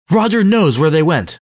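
cling to the one hope left; desperate. A narrator's reading.

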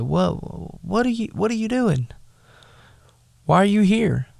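high, mocking what-are-you-doing-here; scornful brothers. Brothers mocking David for showing up, scornful.

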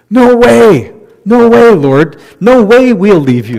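loud, high protest of disbelief; loud "no way" refusal from the disciples; indignant disciples. Acted. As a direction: shout it as shocked followers. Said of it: "how do they take the news".

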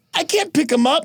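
high, plaintive Aquaman; mock helpless protest.

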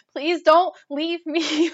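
high, loud, drawn-out retold begging; mock panicked plea. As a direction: imitate yourself begging in a panic. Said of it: "Like, I started panicking again".

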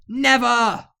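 high, loud heroic refusal; hammed-up storybook hero defiance; defiant shout. A narrator's reading. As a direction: shout it back at the villain. Hero defies the witch.

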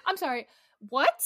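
normal talking voice

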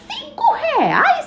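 can't believe the price; shocked. Acted.

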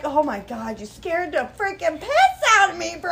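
high, loud relieved outburst; relieved exclaiming. Retold or imitated. Replying to the fan's message, loud and high. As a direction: burst out half laughing with relief. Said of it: "I'm like".

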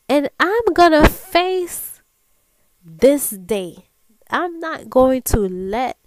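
big, loud declaration; talking back. Acted. Woman telling her inner voice she'll face the day, loud and resolute. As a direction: belt it out like a vow, pausing between phrases. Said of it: "talk back to my inner voice".

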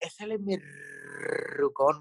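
very slow, drawn-out buddy greeting. Retold or imitated. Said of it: "me decía acá".

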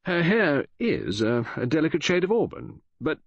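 high, stiff correction; cold. Acted. Friend defends the woman's hair colour, stiff and offended. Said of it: "I said coldly".

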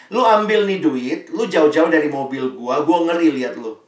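loud, scornful brush-off to a street busker; a rude driver tossing coins to a busker, loud and dismissive; rude giver. Retold or imitated. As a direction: shoo him away loudly.

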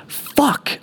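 sharp high yelp of pain; scream. A narrator's reading. Man pinned by the toilet screams in pain. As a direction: yelp it out as the shoulder pops. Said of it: "I screamed".

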